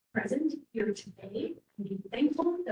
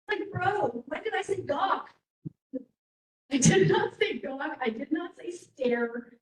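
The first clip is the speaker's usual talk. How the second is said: indignant protest; 5 semitones higher, slower than usual. Real, not acted. Speaker objects to the word gawk.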